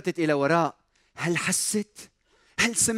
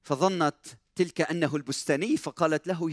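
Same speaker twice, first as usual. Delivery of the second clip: usual speaking voice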